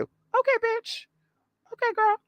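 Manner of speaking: very high, squeaky sarcastic okay; mock agreement. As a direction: humour them in a tiny high voice. Mock reply to boastful witches.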